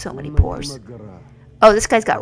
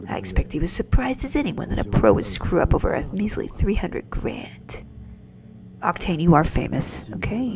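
ordinary delivery

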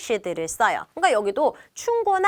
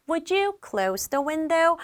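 slow, politely softened request; polite customer asking to close the window; 4 semitones higher, much slower than usual. Shown as a demonstration. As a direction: ask it gently and courteously. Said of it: "되게 정중하죠".

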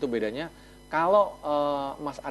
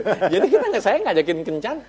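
amused, louder; 8 semitones higher, 8 dB louder than usual. Real, not acted.

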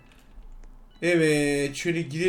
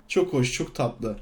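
sinister sweetness; 6 semitones lower, 3 dB quieter, slower than usual. Acted. Gloating as new killer.

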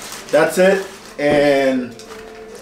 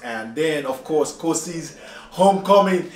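ordinary delivery

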